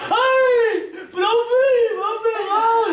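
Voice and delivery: high, loud mock bawling; hammed-up tearful tattling; crying child. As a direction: wail to the teacher like a small boy. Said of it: "Estaba el niño llorando".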